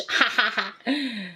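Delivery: short laugh at herself; amused laugh. Real, not acted. Laughs at her witch voice.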